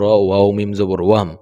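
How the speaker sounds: ordinary delivery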